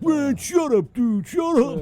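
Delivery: high-pitched imitation of an indignant friend; exaggerated high protest of a teased buddy; mock indignant protest. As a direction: whine back at the teasing, hammed up. Retold friend protests being teased.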